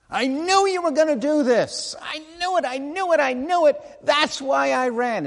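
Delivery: petulant high-pitched grumble, repeating himself; grumpy Jonah. Retold or imitated. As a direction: sulk like a prophet who saw it coming all along. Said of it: "in a very grumpy voice".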